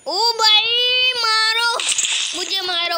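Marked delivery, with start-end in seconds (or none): 0.1-1.8 higher, louder, stretched-out cry; drawn-out call. Real, not acted. Gamer crying out slowly to teammates during the match.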